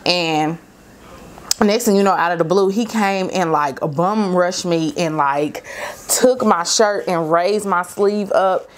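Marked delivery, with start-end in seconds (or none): none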